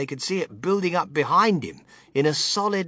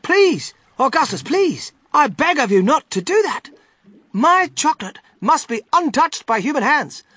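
high, loud, distressed pleading; alarmed, high-pitched cry of protest; 10 semitones higher, 7 dB louder than usual. A narrator's reading. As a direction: beg urgently, voice raised high. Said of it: "he cried out".

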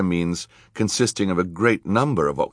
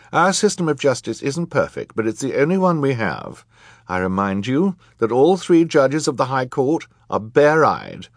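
high, emphatic voice of a judge; stern judge, lecturing; 6 semitones higher, 4 dB louder than usual. A narrator's reading. Judge warning the court about contempt, stern. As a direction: address the court with firm authority.

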